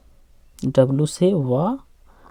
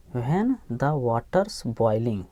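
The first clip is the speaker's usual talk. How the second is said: very slow, each word of This and this is my spot drawn out; slow reading model; 3 semitones lower, much slower than usual. Shown as a demonstration. Teacher reading the line slowly to students. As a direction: read it word by word for the class. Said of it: "teacher models the phrase slowly".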